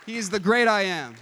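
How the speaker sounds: loud, ringing declaration of the great I am; loud pulpit proclamation; proclaimed. Acted. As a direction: proclaim it to the whole room. Proclaimed after the preaching climax.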